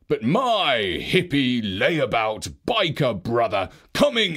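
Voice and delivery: Father Christmas, stern. A narrator's reading. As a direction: rebuke the layabout brother coldly. Father Christmas rebukes brother.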